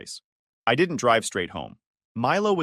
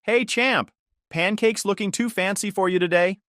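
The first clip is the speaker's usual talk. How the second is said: bright cheerful teasing, pitched up; light and playful; 9 semitones higher than usual. A narrator's reading. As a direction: cheer up a sad kid. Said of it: "I tried to keep things light".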